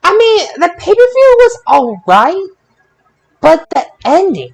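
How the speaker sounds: high exasperated whine; exasperated complaint. Real, not acted. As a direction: vent about the ending. Complaining about pay-per-view ending.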